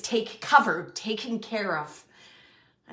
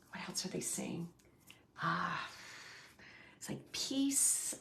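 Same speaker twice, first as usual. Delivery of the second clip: whisper; 4 semitones lower, 10 dB quieter than usual. Real, not acted. Medium feeling peace.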